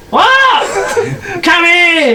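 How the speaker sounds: very high, hammed-up holler; screechy comic call across a house. Gnome household shouts to one another, high-pitched holler.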